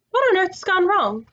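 high-pitched, slightly alarmed question; mildly frightened. A narrator's reading. Polly after the pool fails to work, mildly frightened. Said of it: "said Polly in a frightened voice".

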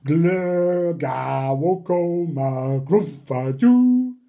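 slow, loud nonsense babble ending in a yelp; a hammed-up creature babble; silly gibberish sounds. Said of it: "make silly sounds like".

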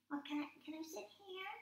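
high, fast little puppet. Acted. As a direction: ask eagerly in a squeaky puppet voice. Puppet voice talking.

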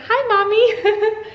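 put-on very high, drawn-out child voice; imitating toddler. Mom repeating what her daughter just said, toddler voice. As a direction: mimic a little girl's slow, high greeting. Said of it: "Blakely just said".

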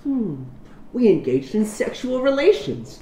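high, soft, slow proposition; mock seduction. Friend spoofing the lover's proposition, mock seductive. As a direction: tease it out in a put-on voice.

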